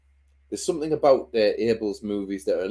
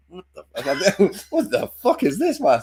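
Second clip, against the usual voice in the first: high-pitched, laughing bafflement; mock-incredulous outburst; retold disbelief; 8 semitones higher, slower than usual. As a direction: act out being appalled, voice climbing through a laugh. Retelling his baffled reaction to film.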